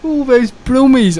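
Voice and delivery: put-on high, drawn-out Brummie accent; hammed-up Birmingham accent, pitched well up; exaggerated Brummie excitement; mock Birmingham accent. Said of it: "I don't like that accent".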